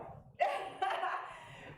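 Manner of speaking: breathy giggle; high laugh. Real, not acted. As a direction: laugh it off lightly. Laughing at her own teasing.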